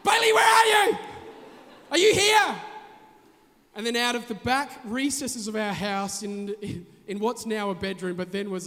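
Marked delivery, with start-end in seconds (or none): 0.0-2.6 high, loud frantic shouting; panicked shout. Retold or imitated. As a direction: yell for the lost son in alarm. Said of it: "we started doing this one".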